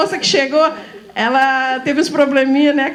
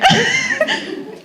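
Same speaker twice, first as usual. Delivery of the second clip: a squeal of laughter, much higher. Real, not acted. Teacher laughing while retelling a newcomer's arrival, high laugh. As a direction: burst out laughing mid-line.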